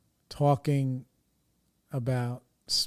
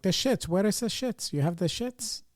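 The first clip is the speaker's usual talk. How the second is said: higher, insistent imitation of mom; mom repeating; 8 semitones higher than usual. Mom repeating her question to the clerk, higher. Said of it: "And she'd like".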